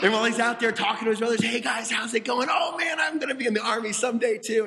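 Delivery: put-on young boy greeting his brothers at the front; excited shouting. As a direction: call out eagerly to the big brothers. David chattering excitedly to brothers.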